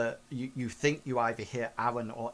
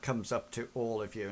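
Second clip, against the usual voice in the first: ordinary delivery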